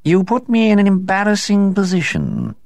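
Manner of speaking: high, unembarrassed cat; high aloof cat; cool cat voice. A narrator's reading. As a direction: say it calmly, not embarrassed at all. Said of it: "did not suggest a shred of embarrassment".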